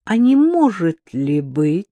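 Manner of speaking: slow, drawn-out purring question; insinuating purr. A narrator's reading. Woman hints that he betrayed her, insinuating purr. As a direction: purr it like a lioness before the hunt. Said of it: "вкрадчивое мурлыканье львицы перед охотой".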